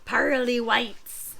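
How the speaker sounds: slow, disgusted pirate curse; cursing pirate. Acted. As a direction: spit the words out slowly. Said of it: "he cursed".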